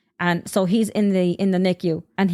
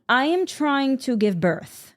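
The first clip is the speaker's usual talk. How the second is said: slow, pointed retort, pitched up; exasperated retort; 5 semitones higher, slower than usual. Retold or imitated. Labouring mother answering the questioners, slow and exasperated. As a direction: spell it out slowly, exasperated. Snapping back at questioners during labor.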